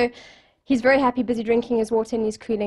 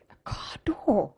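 slow, hushed whisper of bafflement; whispered disbelief; 2 semitones lower, 6 dB quieter, slower than usual. Retold or imitated. Two friends reacting to his odd wish, whispered disbelief. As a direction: whisper it slowly, as if you can't believe it. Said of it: "the other two are like".

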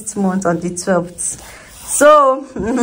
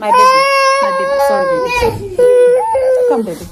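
cooing comfort; 3 dB louder, faster than usual. Real, not acted. Mother soothing her crying toddler, high cooing.

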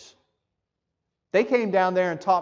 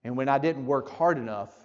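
retold testimony; 6 semitones lower, 6 dB quieter than usual. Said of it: "as our brother Tom was telling me".

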